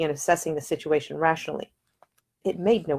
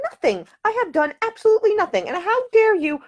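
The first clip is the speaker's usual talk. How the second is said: much higher, offended protest; indignant; 4 dB louder than usual. Acted. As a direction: stand on her dignity. Offended heroine rebuffs accusation.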